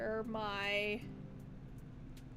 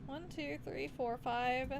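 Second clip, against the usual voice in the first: ordinary talk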